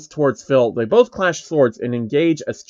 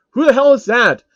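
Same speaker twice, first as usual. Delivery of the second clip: high, loud demanding question; irritated outburst; angry; 9 semitones higher, 5 dB louder than usual. Acted. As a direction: snap at the crowd. Phil angered by voice.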